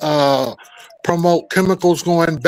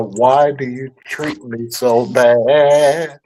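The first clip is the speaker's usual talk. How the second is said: mock lament; 4 semitones lower, much slower than usual. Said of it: "whiny lament, quoted".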